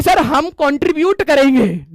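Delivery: very slow, drawn-out begging; mock pleading. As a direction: drag out the desperate promise. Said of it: "बच्चों ने कहा कि". (गिड़गिड़ाते बच्चों की धीमी नकल)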